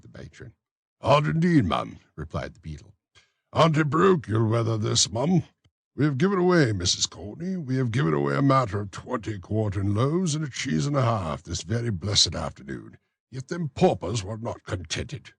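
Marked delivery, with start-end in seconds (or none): 1.0-2.0 raised, fussy official's voice; pompous beadle. Acted. Bumble speaks. 3.5-15.3 raised, fussy official's voice; pompous beadle. Acted. Bumble's complaint.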